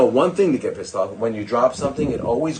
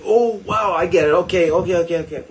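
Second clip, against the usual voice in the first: eager understanding; 6 semitones higher than usual. Retold or imitated.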